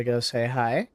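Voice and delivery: normal talking voice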